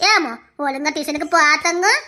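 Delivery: low, doting sing-coax; coaxing mother. Acted. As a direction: sweet-talk a little child. Mother coaxes child to tuition.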